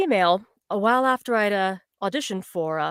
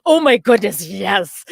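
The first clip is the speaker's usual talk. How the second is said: loud, thrilled retold reply; excited acceptance; 3 semitones lower, 8 dB louder, slower than usual. Actress replaying her reply to the job offer, excited. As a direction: burst out with an eager yes.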